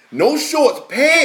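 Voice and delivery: "shorts" and "pants" punched high and loud; punchy, high-pitched outrage on "pants"; incredulous emphasis. Retold or imitated. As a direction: hit "pants" high and hard, mock-appalled. Exasperated emphasis on her covering up.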